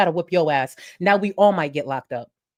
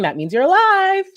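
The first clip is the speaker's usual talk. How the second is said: relieved squeal; 13 semitones higher, 6 dB louder than usual. Retold or imitated. Relieved cry when she wakes.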